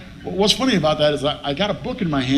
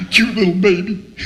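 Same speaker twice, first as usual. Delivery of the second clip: high, blubbering mock sob; mock tearful; 6 semitones higher, 4 dB louder than usual. Emotional man imitating himself at a Tide commercial, mock tearful. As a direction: blubber it as if overcome, played for laughs. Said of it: "I was totally emo".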